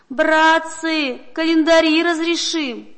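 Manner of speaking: put-on old man crying out. A narrator's reading. Bound old man pleading with the crowd, loud desperate cry. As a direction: beg the crowd at full voice. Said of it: "Он кричит".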